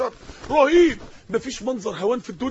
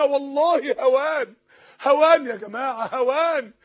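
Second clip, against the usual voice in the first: slow, heavy emphasis on هوان; slower than usual. Real, not acted. Preacher to congregation, slow grave stress. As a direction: drag out هوان slowly and gravely.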